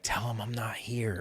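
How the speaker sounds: unvoiced whispered aside; a parent's whispered instruction. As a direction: hush it like hiding from the caller. Parent hiding from phone call.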